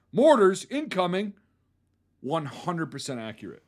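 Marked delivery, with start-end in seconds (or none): 0.1-1.3 higher, louder warning shout; retold yell. Soldier warning his unit of incoming fire, loud shout. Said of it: "to yell at".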